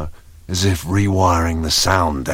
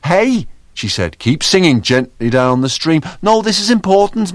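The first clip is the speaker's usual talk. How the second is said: excited cheerful figure; 7 semitones higher, 5 dB louder than usual. Acted. Bright singing voice while searching.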